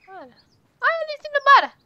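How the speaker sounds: high startled exclaim; surprised exclaim. Real, not acted. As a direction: squeal it out in disbelief. Watching horse run off.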